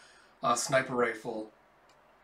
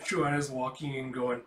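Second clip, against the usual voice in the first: ordinary delivery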